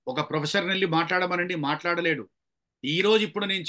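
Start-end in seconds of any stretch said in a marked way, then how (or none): none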